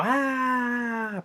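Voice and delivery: high, drawn-out "aaah" trailing off; mock falling scream. Recapping a man thrown out the window, mock scream. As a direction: imitate a long soft scream of someone falling.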